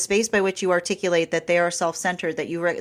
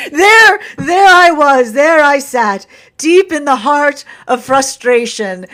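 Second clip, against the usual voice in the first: high, loud, laughing mock drama; mock dramatic telling; 6 semitones higher, 10 dB louder, slower than usual. Parodying an inspired moment, laughing loudly. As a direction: tell it like an epic tale, giggling.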